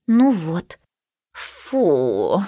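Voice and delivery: a hint of a sniffy cat; scornful cat. A narrator's reading. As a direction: snort it with disdain. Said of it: "фыркнула Миша".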